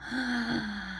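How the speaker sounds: quick audible inhale; showing the class how to inhale; breathing in. Shown as a demonstration. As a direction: suck the air in so the kids can hear it. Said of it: "So that's when you breathe in".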